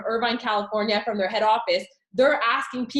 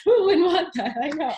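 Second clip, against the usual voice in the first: higher-pitched, laughing rhetorical question; 5 semitones higher, 3 dB louder than usual. Real, not acted. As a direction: say it through an amused laugh. Laughing banter about Benz.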